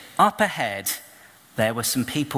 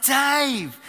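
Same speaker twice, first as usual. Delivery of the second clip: shout; 12 semitones higher than usual. Retold or imitated. Shouting to Dave at the far end of the park. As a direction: yell his name across the park.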